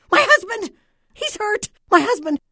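high, loud, frantic screams from a distraught wife; shrill-pitched hysteria, every cry at full force; hysterical screaming. A narrator's reading. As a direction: scream it in total panic over your husband. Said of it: "A woman's voice in hysterical, screaming tones".

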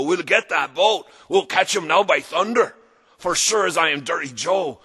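pirate rallying cry. Acted. Pirate captain rallying crew.

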